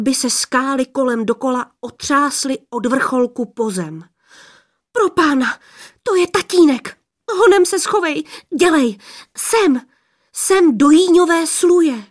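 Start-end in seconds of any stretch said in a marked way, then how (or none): 4.9-12.1 high, loud frightened urging; panicked. A narrator's reading. Maiden hiding the youth from her father, panicked. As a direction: hurry him into hiding in a panic.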